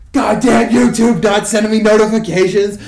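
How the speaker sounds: a friend's furious all-caps rant; all caps shout. Retold or imitated. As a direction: bellow it like a text typed in all caps. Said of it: "all caps".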